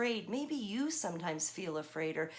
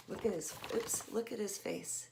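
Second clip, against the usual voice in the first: usual speaking voice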